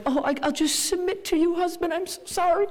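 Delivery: high, timid, apologetic whimper of a doormat wife; mock beaten-down wife. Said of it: "like, wife who's like".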